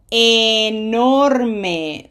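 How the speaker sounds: enorme drawn out very slowly; slow model word. Shown as a demonstration. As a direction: stretch enorme out for the learner. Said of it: "long A".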